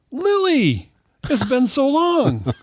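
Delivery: very high mock-delighted squeal, drawn out; gushing reunion. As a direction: greet a long-lost friend, hammed up sky-high. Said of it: "And I go".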